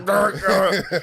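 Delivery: high, loud playful growling noise; laughing mock growl. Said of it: "It's like".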